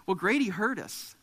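ordinary talk, nothing marked

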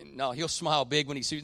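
usual speaking voice

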